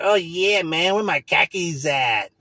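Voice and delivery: hammed-up stoned coworker, drawn out; stoned coworker calling for sour cream, slow mock; mock stoner. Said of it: "other guy's probably like".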